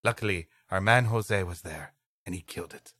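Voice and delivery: usual speaking voice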